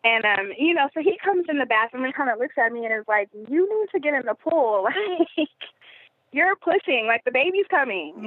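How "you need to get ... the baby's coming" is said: higher-pitched mock urgency; animated quote of an insistent husband; urgent, imitated husband. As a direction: retell his insistent warning with rising urgency. Husband's urgent words acted in story.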